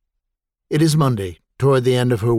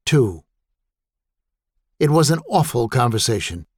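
ordinary delivery

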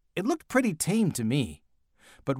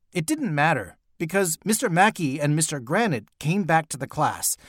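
ordinary delivery, nothing marked

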